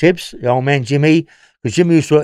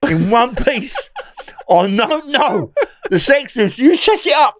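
laughing through the words, pitched up; can't stop laughing as he tells it; 9 semitones higher, slower than usual. Real, not acted. Telling the host about the Sex Pistols, laughing. Laughing while retelling the gig.

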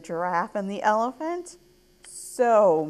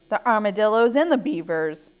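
usual speaking voice

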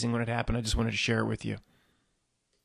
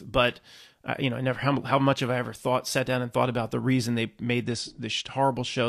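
usual speaking voice

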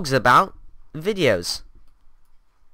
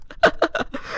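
breathless high giggle; a quick airy mocking laugh; 11 semitones higher, 3 dB quieter than usual. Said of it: "Stop laughing, noob!".

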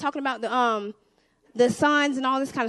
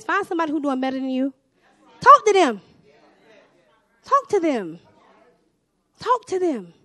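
dad's advice; 3 dB louder than usual. Retold or imitated. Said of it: "when Dad says".

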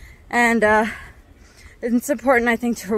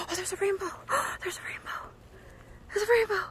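gasp of delight; 9 semitones higher, 10 dB quieter, faster than usual. Real, not acted. Spots a rainbow from summit.

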